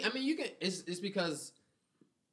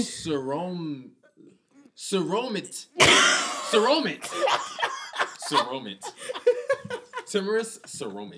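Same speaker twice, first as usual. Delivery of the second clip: timorous read backwards as saromit; laughing attempt at backwards word; backwards speech; 3 semitones higher, 7 dB louder, slower than usual. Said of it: "read this backwards".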